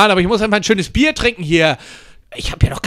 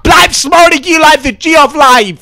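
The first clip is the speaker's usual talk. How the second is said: higher, louder hammed-up yell; over-the-top shouted slogan; catchphrase shout; 7 semitones higher, 5 dB louder than usual. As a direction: belt out the catchphrase. Character catchphrase yelled.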